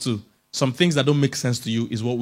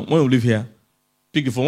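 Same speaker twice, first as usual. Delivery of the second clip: ordinary delivery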